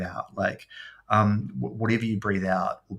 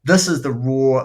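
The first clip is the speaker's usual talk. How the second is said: higher, slowed astonishment; awed; 3 semitones higher, 8 dB louder, slower than usual. Retold or imitated. Hearing old blues records for the first time, awed and slow. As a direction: struck by how raw the music is. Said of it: "you're just like".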